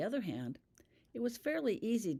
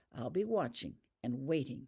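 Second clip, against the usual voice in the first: ordinary delivery, nothing marked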